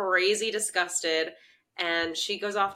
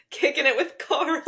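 laughing; 12 semitones higher, 8 dB louder than usual. Real, not acted. Laughing while naming the show.

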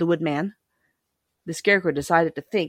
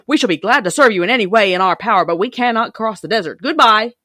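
louder, stately king of the monkeys; firm, regal refusal; a proud monkey king declining politely; 4 semitones higher, 7 dB louder than usual. A narrator's reading.

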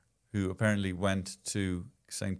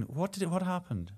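normal talking voice

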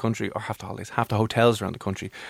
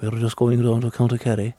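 lightly put-on Kerry accent. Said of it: "could you do Kerry".

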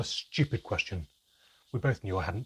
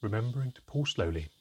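ordinary delivery, nothing marked

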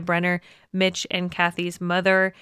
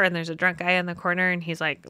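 usual speaking voice

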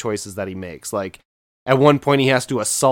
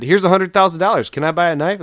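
higher, louder, fast mock pitch; hammed-up eager offer; 7 semitones higher, 5 dB louder, faster than usual. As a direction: overplay the eager customer. Rob Schneider's character's mock tale.

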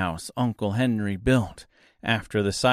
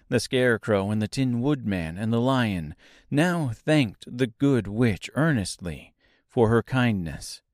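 plain reading voice

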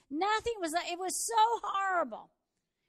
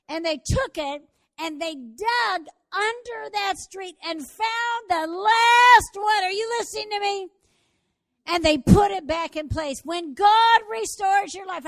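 preaching at full force; 4 semitones higher, 13 dB louder than usual. Acted. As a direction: drive the climax home to the congregation. Sermon climax at full force.

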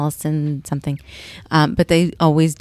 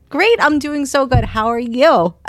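fake-bright greeting; 8 semitones higher, 4 dB louder than usual. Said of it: "looking at him like".

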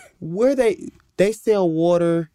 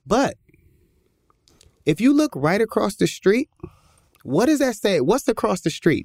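pointed question; 6 semitones higher than usual. Retold or imitated. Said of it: "She was like".

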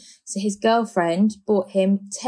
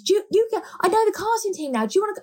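excited offer; 13 semitones higher, faster than usual. Retold or imitated. Offering friends casting contacts.

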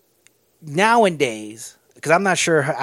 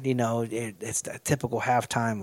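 ordinary talk, nothing marked

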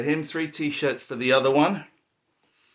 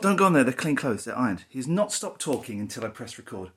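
ordinary talk, nothing marked

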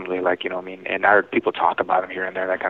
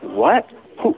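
higher-pitched incredulous "what?"; retold disbelief; 11 semitones higher than usual. Recalling his first reaction to the detective, mock incredulous. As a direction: retell it as a rising, disbelieving question. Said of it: "at first, I laughed".

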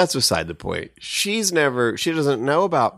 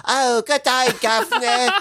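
high-pitched caricature British English; mock British accent; 10 semitones higher than usual. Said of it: "when she talks to her dad, she's all".

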